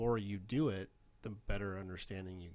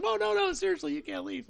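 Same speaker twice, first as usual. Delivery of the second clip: much higher, louder panicky backpedal; panicked teacher; 7 dB louder than usual. Retold or imitated. Teacher begging students to stay, imitated high panic. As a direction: imitate her sudden panic. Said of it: "She's like".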